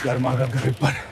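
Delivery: low, soft, deflated; dejected vendor's call. Acted. Newspaper boy finding no buyers, giving up dejectedly. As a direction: let the hawker's cry sag. Hawker gives up, nobody buys.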